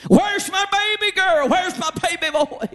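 high, mildly wailing mother, pitch leaping up; crying mother. Retold or imitated. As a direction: plead for the lost baby, a touch of tears. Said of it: "Mothers will be crying".

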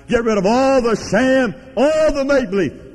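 rising shout of a preacher; preacher cries out against sham; fiery preaching. Acted. Preacher exhorting the church, rising to a fervent cry.

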